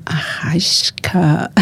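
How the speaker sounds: long, drawn-out whisper; teasing ear whisper. Retold or imitated. Reporter teasing a player in his ear, drawn-out whisper. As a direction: whisper the name slowly to tease. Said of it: "zoar o Arrascaeta ao ouvido".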